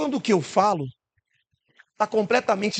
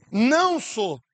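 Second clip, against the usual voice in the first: ordinary delivery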